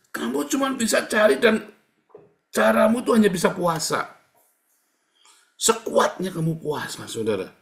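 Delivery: loud, high, driving sermon voice; impassioned preaching. Real, not acted. Preacher urging believers who have no other way, impassioned preaching. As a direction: preach it at full force, pitch climbing.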